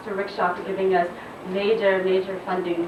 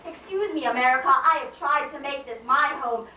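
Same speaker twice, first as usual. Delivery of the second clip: high-pitched slam poetry delivery; spoken-word poem; 6 semitones higher than usual. Acted. Poet confronts America about losing her color, declaimed spoken-word indignation. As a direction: declaim it in measured, punched phrases.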